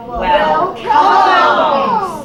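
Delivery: loud, high, drawn-out call; big goat's challenge. Acted. Big goat dares the troll to come, loud challenge. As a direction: call them on boldly.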